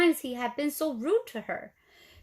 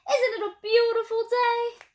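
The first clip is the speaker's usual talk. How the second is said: much higher, louder cheery question; 10 semitones higher, 5 dB louder than usual. A narrator's reading. Tumbleweed cheerfully greeting Hank, high and loud. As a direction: gush about the lovely day.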